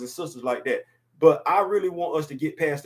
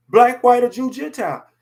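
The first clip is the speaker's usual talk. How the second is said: much louder and higher, bursting out; sudden loud raised cry; outburst; 9 semitones higher, 9 dB louder than usual. Real, not acted. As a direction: let it burst out of you. Emotional outburst about his daughters.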